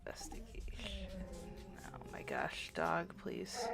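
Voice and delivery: unvoiced whisper. Real, not acted. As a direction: whisper to yourself. Whispering while rolling.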